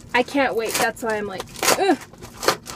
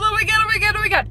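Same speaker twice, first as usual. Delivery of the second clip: squealing with excitement; overjoyed, fast and high; shrieking jackpot winner; 6 semitones higher, 4 dB louder, faster than usual. Real, not acted. Excited about mail arrival.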